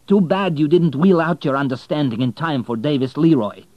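loud, high, cutting reproach; bitter anger. Acted. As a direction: spit the blame at him. Bitter confrontation with the banker.

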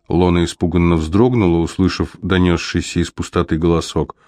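ordinary delivery, nothing marked

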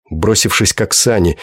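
usual speaking voice

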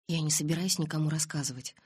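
usual speaking voice

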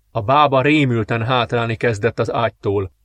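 plain reading voice

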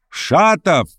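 high, drawn-out warning call; enigmatic call. A narrator's reading. Verkhovensky calls after departing Shatov, with enigmatic warning. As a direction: call after someone leaving, meaningfully. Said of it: "загадочно крикнул ему вслед".

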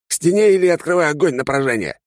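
high, harsh shouted command through a mask; masked soldier order. Acted. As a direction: bark the order like a masked trooper. Masked soldier threatens to shoot.

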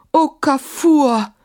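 high, slow, drawn-out lament; long pleading exclamation, falling at the end; plaintive cry. A narrator's reading. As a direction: wail the name in despair. Caliph laments to returned djinn.

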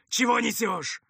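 high, loud snap of irritation; angry rebuke. Acted. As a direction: cut off nonsense sharply. Commander rebukes alarmed helper.

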